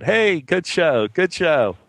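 calling out. Retold or imitated. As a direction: sing out a cheery hey, good show. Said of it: "called out to him".

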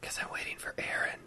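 secretive hushed whisper; whisper, waiting for someone; worried whisper. Real, not acted. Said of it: "Why are we whispering?".

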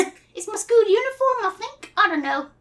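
high-pitched English schoolboy; little British boy accent. Acted. Christopher Robin greets Piglet in a little British boy accent. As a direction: announce yourself as a small British boy. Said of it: "your little British boy accent".

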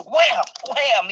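high punchy wham sounds; punch sound effect. Retold or imitated. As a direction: smack out the hits, high. Imitating harder punches landing.

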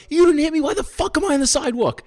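high, loud mock shout; retold furious yelling. Shouting at the drivers who sideswiped him, high and loud. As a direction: yell it back at them in disbelief.

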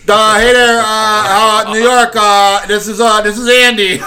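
high, loud hesitant ref on the radio; hammed-up walkie-talkie call; mock radio call. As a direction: play a nervous referee keying the radio.

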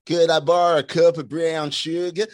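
Brit's rough go at American; attempted American accent. Shown as a demonstration. British host shows his attempt at borrowing sugar, American accent. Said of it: "I don't do an American accent".

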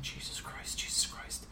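hushed, private alarmed oath; aghast whisper to yourself; whispered dismay. Retold or imitated. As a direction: whisper it under your breath. Alarmed reaction to rough seatmates.

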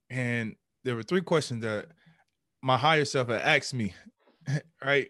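ordinary delivery

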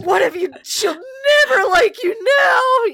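put-on a bird boy, convinced he has blown his crush; anguished teen wail. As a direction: wail it like your life just ended.

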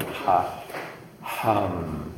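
H into hum; breathy h. Shown as a demonstration. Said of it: "That's very easy to feel".

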